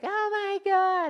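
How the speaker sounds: very high, loud, drawn-out squeal; mock fangirl squeal, long and high; swooning girls. Every girl in the bar seeing [name], squealing mockingly. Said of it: "every girl in the bar was like".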